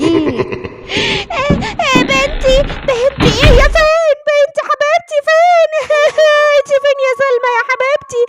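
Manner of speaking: high, loud panicked cries; frantic mother wailing. Acted. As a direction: wail for your missing child. Mother finds daughter kidnapped.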